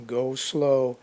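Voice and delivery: ordinary talk, nothing marked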